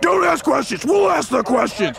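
high, barked agent's retort; shouted, no-nonsense rebuke; gruff shout. Acted. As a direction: snap back gruffly like an agent. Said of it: "agents just respond gruffly".

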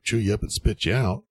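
ordinary talk

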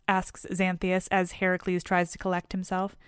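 ordinary delivery

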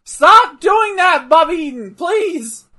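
high, loud, pleading shout. Real, not acted. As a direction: yell at the screen to stop. Yelling at wrestler on TV.

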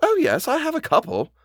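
high, airy reply with mild amusement; laughed reply. A narrator's reading. Governess answers the housekeeper's surprise, mildly amused. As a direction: lift the pitch as if lightly amused. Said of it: "I laughed".

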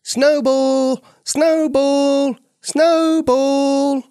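high, loud calling for a lost kitten; searching neighbours calling out loud. A narrator's reading. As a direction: call the kitten's name across the alley. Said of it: "They were calling out".

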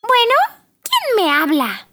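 high, curt snobby greeting; haughty phone answer. Acted. Pina answers phone coldly.

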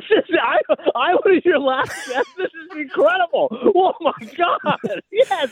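high, laughing squeals of joy; shrieking jackpot winner. Acted. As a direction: shriek like you just won the lottery. Thinks he won the prize.

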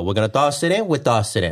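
put-on singsong Italian accent, lifting then dropping; Italian game-show host's playful patter. Husband holding up objects on a pretend game show, Italian accent. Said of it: "It was an Italian game show".

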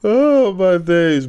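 slow, drawn-out and loud; excited. Real, not acted. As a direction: stretch it out in delight. Excited reaction to Top Boy.

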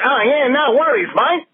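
high-pitched mock Australian; Australian accent. Caller mimics how Australians say a borrowed phrase, high mock Australian accent. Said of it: "That's actually Australian that became".

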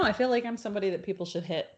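normal talking voice

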